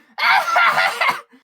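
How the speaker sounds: high, loud mocking cackle. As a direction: burst into a wild laugh. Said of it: "Gleb just starts laughing".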